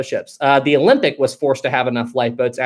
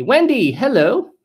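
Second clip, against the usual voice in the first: bright greeting; 8 semitones higher, slower than usual. Real, not acted. Cheery greeting to a chatter.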